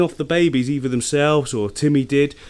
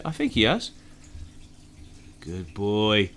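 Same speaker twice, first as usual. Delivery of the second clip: normal talking voice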